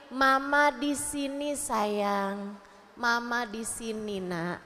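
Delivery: soft, slow, low reassurance; tender mother. Acted. Mother comforting her crying child, tender and reassuring. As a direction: soothe a crying child very slowly.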